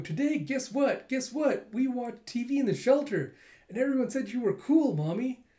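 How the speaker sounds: put-on high, eager child voice; excited little girl. Kokoro telling her mother about the shelter, high and eager. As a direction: bubble over telling mom the news.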